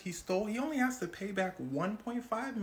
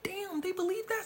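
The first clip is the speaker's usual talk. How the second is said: much higher amazed question; 14 semitones higher than usual. Retold or imitated. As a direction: marvel at how gullible they are. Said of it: "Hush Puppy said".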